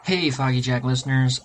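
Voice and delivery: ordinary talk, nothing marked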